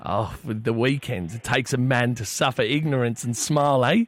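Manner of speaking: chatty fake English caller; poor English accent. Prank caller slips song lyrics to cafe, poor English accent. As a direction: ramble on in a badly faked English accent. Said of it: "disregard the piss poor English accent".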